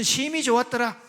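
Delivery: loud standard Seoul-Gyeonggi version; rousing Seoul-standard cheer; standard Seoul speech. Shown as a demonstration. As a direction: proclaim it in a standard Seoul accent. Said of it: "서울, 경기 표준".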